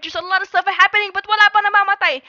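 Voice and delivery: fast, high, loud hype; excited play-by-play. Acted. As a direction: rattle it off at full speed. Frantic teamfight call.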